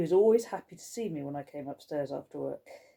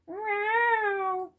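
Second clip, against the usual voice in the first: high, drawn-out mock meow; girl's cheeky cat meow. Girl mimicking the cat to fool Skullface, cheeky meow. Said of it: "yelled back at her".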